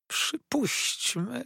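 high, very drawn-out challenge; defiant supposition. A narrator's reading. Villain refusing to hand over papers, high drawn-out challenge. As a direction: stretch the word high, testing the opponent. Villain defies the lawyer's demand.

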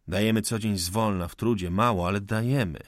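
ordinary delivery, nothing marked

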